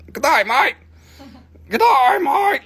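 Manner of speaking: a loud, laughing mock Aussie greeting; Australian accent. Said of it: "This is from Australia".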